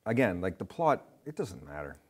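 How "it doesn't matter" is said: usual speaking voice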